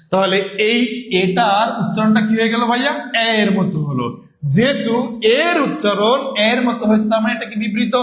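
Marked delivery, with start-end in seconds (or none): none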